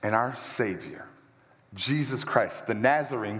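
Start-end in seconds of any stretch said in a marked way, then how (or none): none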